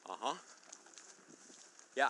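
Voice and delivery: ordinary talk, nothing marked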